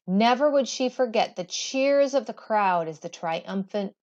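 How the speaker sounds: plain reading voice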